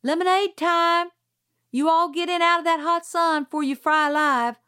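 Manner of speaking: high, loud call across the yard. A narrator's reading. Calpurnia yells to the children in the yard. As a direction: call the kids in from the porch. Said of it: "Calpurnia appeared ... and yelled".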